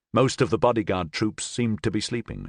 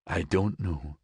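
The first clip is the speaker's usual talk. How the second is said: quiet, shaky man's voice; 3 semitones lower, 4 dB quieter, slower than usual. A narrator's reading. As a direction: voice trembling with hurt. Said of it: "he said in a shaky voice".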